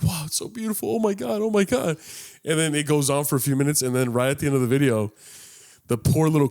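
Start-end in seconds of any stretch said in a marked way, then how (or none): 0.0-2.0 higher, breathless wonder; awed scientists. Retold or imitated. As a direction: gasp over something beautiful. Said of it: "they're just like".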